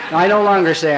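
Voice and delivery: ordinary delivery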